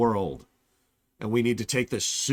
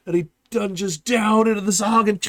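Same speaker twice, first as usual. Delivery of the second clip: mock epic excitement; 10 semitones higher, 7 dB louder than usual. Mock epic fight narration.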